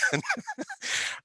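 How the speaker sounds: high chuckle at own joke; short, high-pitched giggle. Real, not acted. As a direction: laugh lightly at your punchline. Laughs after own joke.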